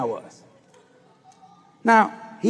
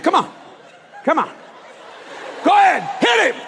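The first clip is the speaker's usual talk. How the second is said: egging on; 10 semitones higher than usual. Retold or imitated. Manager egging on.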